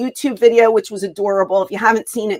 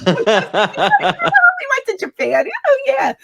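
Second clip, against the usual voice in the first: mock vague recall; slower than usual. Mimicking Shawn faking remembering.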